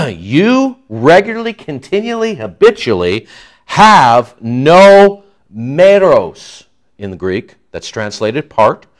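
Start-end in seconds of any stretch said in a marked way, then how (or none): 0.1-6.6 each adverb stressed, meros drawn out slowly and loud; emphatic paraphrase. Shown as a demonstration. Preacher unpacking the Greek tense for the congregation, emphatic. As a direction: hammer home the habitual tense. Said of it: "present tense, active voice".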